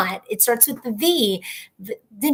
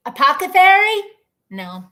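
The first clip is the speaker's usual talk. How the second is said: ordinary talk, nothing marked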